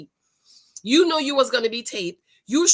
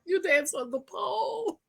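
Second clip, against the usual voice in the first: mock disbelief; 8 semitones higher, 5 dB quieter than usual. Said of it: "And he was like".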